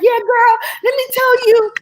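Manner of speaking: very high, loud, egging-on voice; imitated hyped-up grandma. Granddaughter acting grandma boosting her up, high excited voice.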